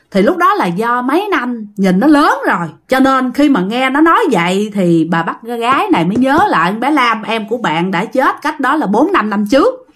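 usual speaking voice

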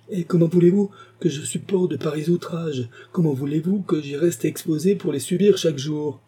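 high-pitched, indignant pleading; distressed protest. A narrator's reading. Woman protests outrages she endures.

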